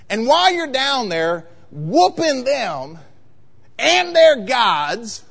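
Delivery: drawn-out, preachy sarcasm; sarcastic prophet. Acted. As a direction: spell it out pointedly for a foolish king. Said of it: "I almost sense a tone of sarcasm".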